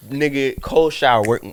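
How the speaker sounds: usual speaking voice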